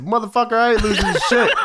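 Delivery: high, laughing mock Taz; hammed-up tough guy boast; imitating Taz. Co-host voices Taz boasting he never loses, laughing. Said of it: "He said".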